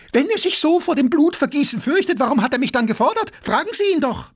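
very high, enraged challenge; furious bellow. A narrator's reading. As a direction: roar the question at your second, speeding up. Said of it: "brüllte Gaganow". (wütend brüllend)

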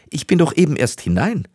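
high, mildly protesting call; calling back. A narrator's reading. Young wife answers her husband from the water, calling back. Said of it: "rief die junge Frau".

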